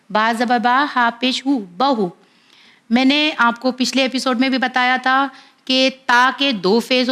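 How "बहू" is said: ordinary talk, nothing marked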